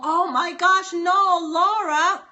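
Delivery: slow, stretched high no, Laura; surprised. Real, not acted. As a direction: draw out the disbelief. Surprised reaction to Laura's comment.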